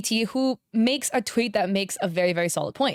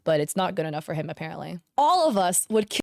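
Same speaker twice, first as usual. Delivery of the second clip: ordinary talk, nothing marked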